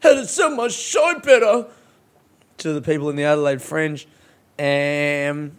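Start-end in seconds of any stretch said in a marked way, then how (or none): 0.0-1.7 higher, louder odd delivery; weird high voice. Shown as a demonstration. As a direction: say it in a strange high voice. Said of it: "I said that weird".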